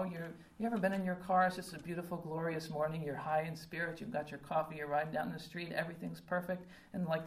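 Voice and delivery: usual speaking voice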